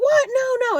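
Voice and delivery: high, loud mock protest; shocked no. Date objecting to no real iPhone, high mock shock. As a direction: squeal out his disbelief.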